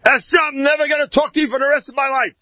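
high-pitched furious retold yell; angry yelling. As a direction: rattle it off as an enraged older brother's outburst. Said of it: "And he yelled at her".

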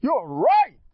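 high, full-volume shout of agreement; screamed concession. Shown as a demonstration. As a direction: scream the admission out loud. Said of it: "I scream".

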